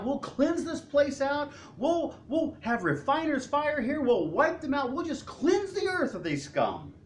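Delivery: high, hammed-up zeal, slow at first; a fired-up zealot calling down judgment; zealous James. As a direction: James ranting to the Lord, high and fervent. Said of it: "But James is like".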